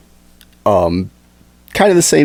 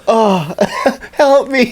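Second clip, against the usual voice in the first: mock pleading; 10 semitones higher, much slower than usual. Mocking the Karen after crash.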